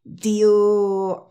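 'Do you' with no closing D; D left out. Shown as a demonstration. Teacher starting another example for students, D left out. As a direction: glide from 'Do' into 'you'. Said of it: "Dilo sin la D".

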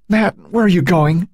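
high, anxious mother calling after her son; alarmed call. A narrator's reading. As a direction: call out to stop him leaving.

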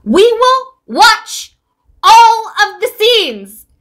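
loud, high, slow pleading rant; exasperated plea. Real, not acted. Fan begging the showrunners to keep scenes, loud exasperated plea.

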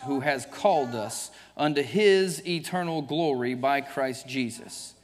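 normal talking voice

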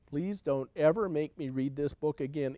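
plain reading voice

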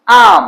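আম high and loud, closing on 'm'; stopped final consonant. Shown as a demonstration. Teacher models for students, আম stopped at the 'm'. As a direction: punch আম and stop on the 'm'. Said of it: "উচ্চারণ থেমে যাচ্ছি".

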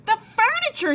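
very high, loud cry of dismay; shocked high-pitched exclamation; horrified cry. A narrator's reading. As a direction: cry out aghast, pitch shooting up. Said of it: "she cried".

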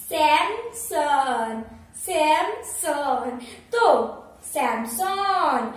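सैमसंग very slowly, SAM... SON; slow drilling. Shown as a demonstration. Teacher drilling the brand name for viewers, very slowly. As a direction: drill सैमसंग with both syllables stretched out. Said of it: "और क्या है ये".

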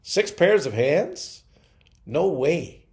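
incredulous. Acted. Angel doubting God's specs, incredulous and slow. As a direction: stretch Six pairs of hands into a stunned question. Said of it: "shook her head slowly and said".

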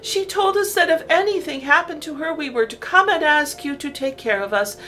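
high, pleading little rabbit; a child rabbit asking for help; small rabbit voice. A narrator's reading. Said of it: "repeated all the little rabbits".

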